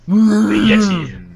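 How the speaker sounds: high, drawn-out Yeti call; badly faked Yeti howl; terrible Yeti voice. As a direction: stretch it into a silly high monster cry. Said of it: "I'm terrible at Yeti".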